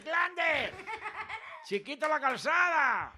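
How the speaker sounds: high, excited shout of acclaim; high-pitched, hyped exclamation; excited cheer. Real, not acted. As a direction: call the name out high like a fan. Host cheering the comedian's catchphrases.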